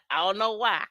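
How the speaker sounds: usual speaking voice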